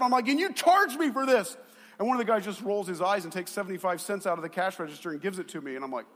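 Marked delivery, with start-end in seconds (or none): 0.3-1.6 raised, indignant complaint; louder and higher, in outraged disbelief; fired up. Retold or imitated. Customer protesting the bill to staff, fired-up indignation. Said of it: "I was getting fired up".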